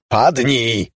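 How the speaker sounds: loud, high warning shout; urgent yell. A narrator's reading. Panicked veteran yells at lover as doors slam. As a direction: yell the order as if under fire. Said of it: "wrzasnął ponownie Leny".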